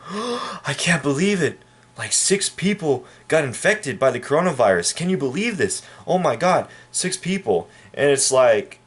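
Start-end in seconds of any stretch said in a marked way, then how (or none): 0.0-7.7 high, breathless mock hysteria; mock panic. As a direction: ham up a panicking local. Mocking panicked people over six cases.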